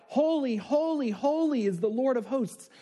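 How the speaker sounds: high, ringing cry of holy, holy. Acted. Seraphim crying to one another, ringing and high. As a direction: call it out like the seraphim.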